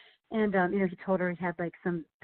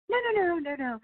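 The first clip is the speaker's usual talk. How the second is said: much higher string of quick no's; mock horrified refusal; guests refusing; 10 semitones higher than usual. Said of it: "Everyone's like".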